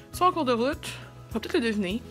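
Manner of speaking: ordinary delivery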